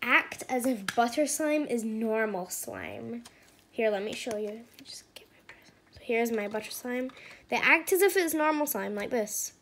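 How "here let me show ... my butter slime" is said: soft unvoiced whisper; hushed ASMR whisper. Real, not acted. Whispering while showing slime.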